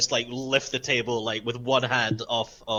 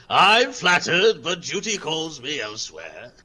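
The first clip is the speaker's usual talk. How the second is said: slow, loud, grand opening easing off; gallant Clargard; 3 semitones higher, 3 dB louder, slower than usual. Acted. Warrior turning down a proposition, gallant. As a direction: decline grandly, drawing out the start. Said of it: "holds his hands up and goes".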